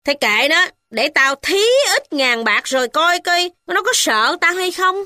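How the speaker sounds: high, haughty scoff; dismissive boast. A narrator's reading. Mother boasting of her money, haughty high voice. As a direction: wave him off with contempt. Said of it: "Bà cai khoát tay nói rằng".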